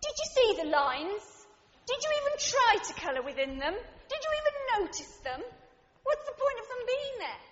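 hammed-up disbelief at the colours; mock outrage at a crayon drawing; exasperated critic. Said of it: "What I really wanted to say was".

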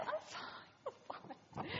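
breathy whisper, pretending all is well; whispered denial. [name] to Simon in the cable car, a fearful whisper. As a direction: whisper it, covering panic.

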